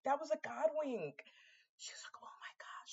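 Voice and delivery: whispered in awe; hushed reverent whisper; awed whisper. Retold or imitated. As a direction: whisper it like a secret. Friend's whispered God wink.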